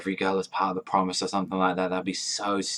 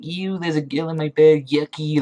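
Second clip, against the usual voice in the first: put-on higher, louder, childish grossed-out whine; mocking a prissy, higher, louder yuck; mock disgusted kid; 7 semitones higher, 5 dB louder than usual. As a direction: ham up a squeamish boy going ew. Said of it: "instead of Raku saying".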